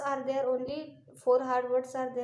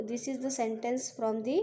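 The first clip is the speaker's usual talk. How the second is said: usual speaking voice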